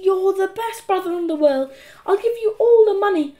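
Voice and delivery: high, eager little-brother voice; pleading flattery. A narrator's reading. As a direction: sweet-talk your brother into rescue.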